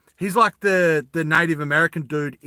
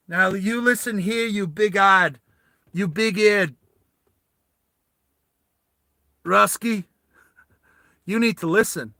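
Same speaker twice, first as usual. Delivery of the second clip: put-on Southern accent; 4 semitones higher than usual. [name]'s character warns the Russian, Southern accent. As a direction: warn him in a strong Southern accent. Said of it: "in a real Southern accent".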